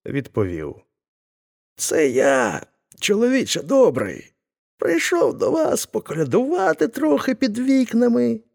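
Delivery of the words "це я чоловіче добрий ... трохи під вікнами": put-on voice, much higher, to hide who he is; a sly old man faking a stranger's voice; disguised voice. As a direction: pretend to be a humble caroler. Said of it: "І, змінивши голос".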